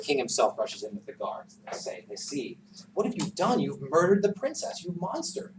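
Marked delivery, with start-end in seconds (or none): 2.9-5.5 angry accusation. Acted. King accusing Peter.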